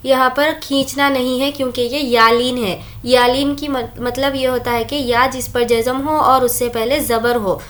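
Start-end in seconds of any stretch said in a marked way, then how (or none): none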